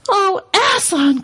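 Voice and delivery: high, gasped exclamation; astonished cry. A narrator's reading. Children seeing Aslan alive, astonished high cry. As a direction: cry out high in startled wonder. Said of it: "cried both the children".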